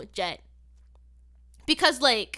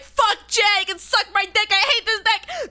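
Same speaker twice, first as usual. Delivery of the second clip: higher, heated outburst; mock-furious venting, pitched up; retold angry rant; 6 semitones higher than usual. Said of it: "triggered that tweet where I was like".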